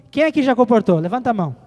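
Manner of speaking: ordinary delivery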